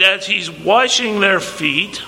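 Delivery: usual speaking voice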